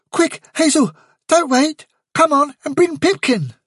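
little rabbit pleading to go now; nervous small rabbit; Fiver's high urgent voice. A narrator's reading.

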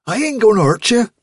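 put-on a girl reassuring the frightened rabbit; urgent reassurance. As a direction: promise the rabbit it's safe.

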